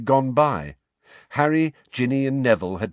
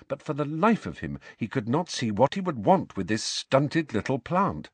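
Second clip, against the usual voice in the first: usual speaking voice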